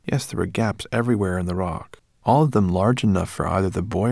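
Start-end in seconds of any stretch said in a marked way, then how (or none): none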